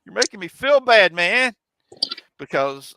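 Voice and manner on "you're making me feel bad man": high, louder hammed-up whining; mock whine. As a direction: play the hurt kid for laughs. Young self complaining.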